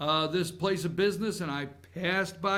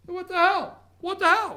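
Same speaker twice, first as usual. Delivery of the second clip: higher and louder, outraged; indignant exclamation; 12 semitones higher, 5 dB louder than usual. Retold or imitated. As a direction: throw it out in disbelief. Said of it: "indignant exclamation".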